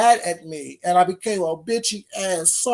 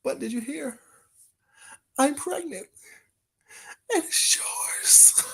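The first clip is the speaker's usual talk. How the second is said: soft, high melodramatic confession; movie-scene impression; 5 semitones higher, 8 dB quieter, slower than usual. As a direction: fade into a drawn-out mock-tearful whisper. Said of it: "It's from a movie".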